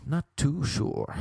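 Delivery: puzzled character voice; put-on doubtful voice. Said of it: "repeated in odd voice".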